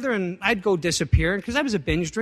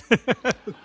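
brief real chuckle; quick laugh at himself; short laugh; 5 semitones higher, 5 dB louder than usual. Real, not acted. Laughing at own joke.

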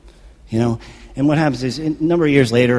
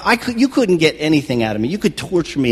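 ordinary talk, nothing marked

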